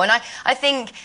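usual speaking voice

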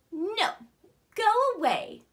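loud, high snap of no, go away; a bird rudely shouting go away; rude brush-off. Acted. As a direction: shoo them off rudely. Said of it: "said the ostrich".

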